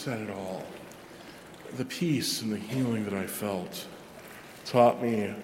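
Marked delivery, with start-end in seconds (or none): none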